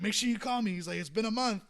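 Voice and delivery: insistent. Retold or imitated. Charlie insists.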